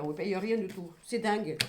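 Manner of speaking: ordinary talk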